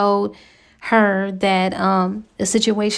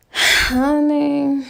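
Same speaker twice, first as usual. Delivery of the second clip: knowing sigh; 6 semitones higher than usual. Real, not acted. Storyteller to her audience, sighing, sassy knowing aside. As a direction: sigh then a high, sassy "Honey".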